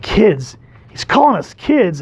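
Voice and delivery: put-on high, slow indignant he's calling us kids; hammed-up teen outrage; a touch of insulted teen; mock offended. Teens offended by Cap.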